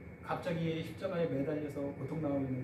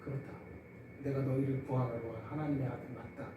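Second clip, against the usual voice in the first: calm solemn answer; 4 semitones lower, 7 dB quieter than usual. Retold or imitated. Jesus answers the mockers calmly.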